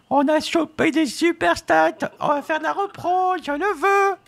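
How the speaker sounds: gleeful high-pitched cry to Vixie about the stats; high excited squeal. Real, not acted.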